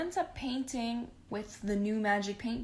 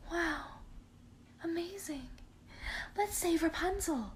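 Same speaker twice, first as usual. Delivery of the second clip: much higher, breathy mock wonder; mock amazed dad; 8 semitones higher, 5 dB quieter than usual. The dad suddenly believes Penelope, higher mock amazement. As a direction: overplay the sudden conversion. Dad's sudden change of heart.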